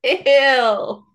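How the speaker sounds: high, drawn-out ew of disgust; disgusted squeal at a gross image. Real, not acted. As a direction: recoil in disgust, pitch jumping up. Disgusted at the quoted line.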